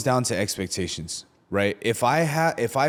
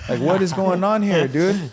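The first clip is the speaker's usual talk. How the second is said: mock outrage; 6 semitones higher, 4 dB louder than usual. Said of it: "I'm like".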